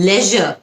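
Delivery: "লেজার" as LEH-zhuh; short E in "লেজার", no r; British pronunciation. Shown as a demonstration. Teacher switches to British accent, "লেজার" as LEH-zhuh. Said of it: "British অ্যাকসেন্টের".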